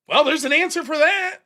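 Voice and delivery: ordinary talk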